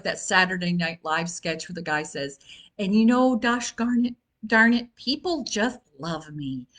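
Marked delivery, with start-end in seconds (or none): 2.8-6.7 lightly voiced earnest self-help guy, higher and louder; hammed-up sincere affirmation, pitched up; Al Franken. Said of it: "a terrible imitation of Al Franken".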